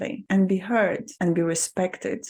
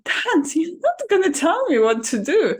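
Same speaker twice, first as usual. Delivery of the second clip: defiant retort; 9 semitones higher, 5 dB louder than usual. Retold or imitated. Retold standing up to her dad.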